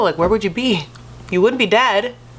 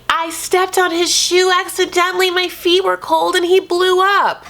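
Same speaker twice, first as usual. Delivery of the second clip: much higher, put-on whiny voice for Darcy; mock Darcy voice; 12 semitones higher than usual. Reality star mimicking wife's excuse, high put-on whine. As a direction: squeak out her excuse like a defensive wife. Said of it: "So Darcy's like".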